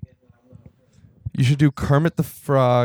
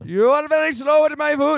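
put-on high-pitched Indian-accented complaint; hammed-up Indian accent, indignant; mock Indian accent; 11 semitones higher than usual. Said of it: "yelled at a lot by almost exclusively Indian".

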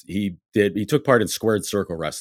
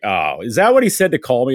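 higher, louder, catching on; knowing realization; 6 semitones higher, 7 dB louder than usual. Retold or imitated. Rob realizing Huber set him up, with a knowing tone. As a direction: catch on and call it out. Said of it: "And he's like".

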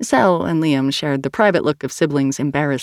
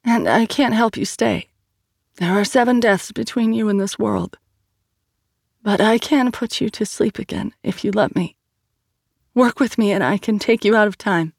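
thickly; 6 semitones higher than usual. A narrator's reading. Aisha pleads with Ifrit.

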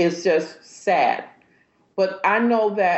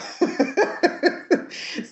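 high breathy laugh; 10 semitones higher than usual. Real, not acted. Laughs after story.

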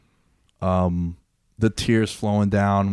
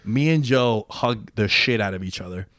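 ordinary talk

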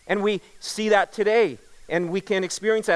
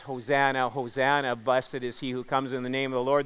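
usual speaking voice

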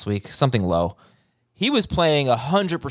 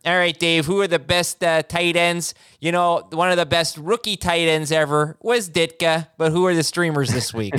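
lightly put-on Chicago accent, higher; 6 semitones higher than usual. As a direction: ask it in a hint of a Chicago accent. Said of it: "you could do the Chicago accent".

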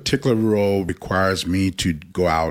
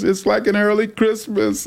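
much higher put-on voice of an overjoyed older client; imitating the lady; 3 dB louder than usual. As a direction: mimic the tearful client's delight, pitched way up. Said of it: "And she goes".

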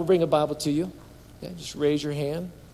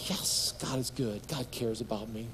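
very soft, low and tender; softly voiced realization of God's care; hushed assurance; 5 semitones lower, 11 dB quieter than usual. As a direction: drop to a quiet, heartfelt affirmation. Hushed resolution of the psalm.

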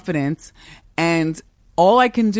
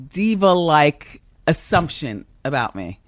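normal talking voice

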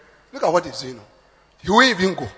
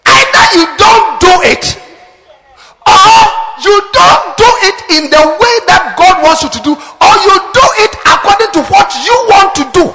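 preaching shout; 7 semitones higher than usual. Real, not acted. Preaching climax continues.

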